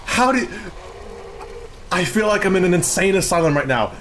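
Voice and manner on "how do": exasperated. Real, not acted. Exasperated at chat.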